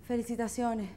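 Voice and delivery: softly spoken, with a touch of grief; understated funeral-visitor solemnity; mock sorrowful condolence. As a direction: offer it gravely and quietly. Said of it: "con mucho pesar".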